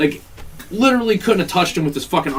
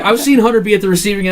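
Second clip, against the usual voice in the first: ordinary delivery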